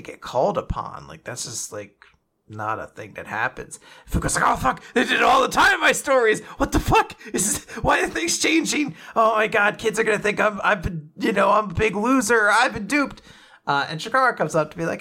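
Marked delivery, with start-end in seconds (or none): 4.4-13.2 high, fast mock panic; panicky Fuko. Fuko panicking in class, voiced high and fast. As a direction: babble it in a rising fluster.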